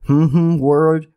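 playful hummed stand-in for a jinxed word; teasing hmm. Pretend. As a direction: hum the word instead of saying it. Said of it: "I just refuse to say those words".

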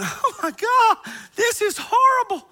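seeing stars at the top of the stairs; gasping dismay. Retold or imitated. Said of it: "I'm going".

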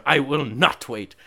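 a mock stubborn no; hammed-up defiant no; retold refusal. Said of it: "She's like".

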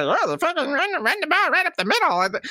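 high, mocking nerd voice; mocking analyst. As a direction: mimic the egghead chart guy, high and snide. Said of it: "egghead charts that say".

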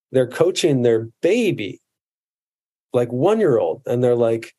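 ordinary talk

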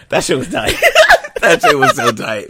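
high, loud words through laughter; laughing. Real, not acted. Cohost raving about the episode, laughing. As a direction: crack up while praising it.

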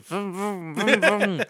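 short revs climbing in pitch; engine revving imitation. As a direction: imitate a small car revving. Imitates own car revving.

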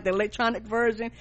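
ordinary delivery

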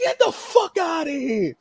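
very high-pitched exasperated yell; retold outraged shouting; going nuts. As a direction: imitate a promoter ranting in disbelief. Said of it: "He's going nuts".

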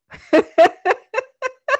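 big burst of laughter; loud high laugh. Real, not acted. Laughs at niece quote.

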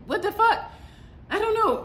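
high incredulous what the fuck. Real, not acted. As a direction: thrown by the remark, pitched high.

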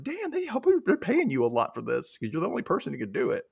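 impressed exclaim. Retold or imitated. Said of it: "I'm like".

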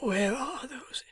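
barely voiced, dying breath; a dying man's faint whisper. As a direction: whisper it weakly from a sickbed.

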